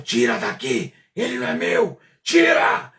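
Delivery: strange demon voice building to a loud furious roar. Retold or imitated. Furious demon ordering the demons to take him away, roaring. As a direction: bellow the order like a raging demon. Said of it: "E falava furioso".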